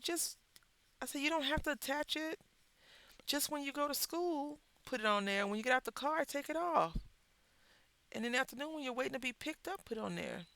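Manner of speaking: patient mom instructing. Retold or imitated. Mother instructs son, raised pitch.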